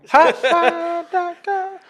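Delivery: slow, high announcer voice; hammed-up sponsor tag; mock ad read. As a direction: stretch it out like a radio ad. Mocking ad tagline.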